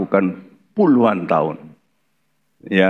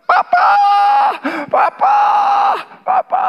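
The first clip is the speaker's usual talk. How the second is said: put-on high shrieking child calling papa; child wailing for daddy on a plane; screaming child; 4 dB louder than usual. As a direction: shriek for papa like a bawling toddler. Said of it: "anaknya yang jerit".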